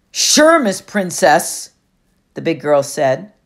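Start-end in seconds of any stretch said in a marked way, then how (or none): 0.1-1.7 loud, slow, husky taunt; mocking bully. Big girl mocks Annabeth, loud slow husky sneer. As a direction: drag out the insult like a smug bully.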